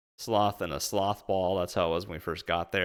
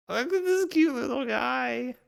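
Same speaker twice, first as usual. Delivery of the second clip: cooing over cuteness; 3 dB louder than usual. Real, not acted. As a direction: gush at a tiny cute animal. Cooing over a cute animal.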